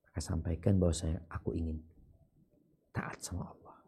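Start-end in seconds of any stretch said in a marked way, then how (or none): 2.9-3.8 whisper. Real, not acted. Soft sermon advice.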